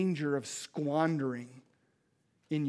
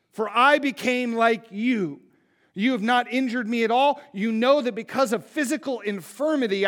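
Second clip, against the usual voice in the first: louder, pleading reading of Paul's letter; impassioned scripture reading; 3 semitones higher, 5 dB louder than usual. Acted. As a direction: read it as Paul begging his friends. Reads scripture as Paul pleading.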